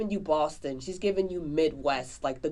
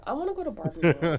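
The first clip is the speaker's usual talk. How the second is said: laughing wish; much faster than usual. Real, not acted. Longing to escape to Barbie world.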